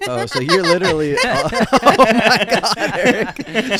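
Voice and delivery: cracking up at a friend's silly voice; laughing disbelief. Real, not acted. Laughing at Lonnie voice.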